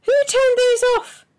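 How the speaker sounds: high, loud call from the next room. A narrator's reading. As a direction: shout the question across the house. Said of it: "she called".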